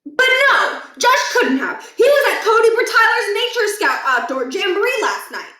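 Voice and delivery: high, loud protest; agitated defense. Acted. Principal insisting to the boys that his son is innocent.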